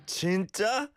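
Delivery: high-pitched incredulous question; mock astonishment, pitched right up; disbelieving. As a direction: imitate someone who can't believe it. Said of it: "someone told you something ... ridiculous".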